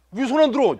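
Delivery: high, loud playful demand; mock indignation. Pastor asking why hands stay down, mock indignant. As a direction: call them out in pretend outrage. Teases members not raising hands.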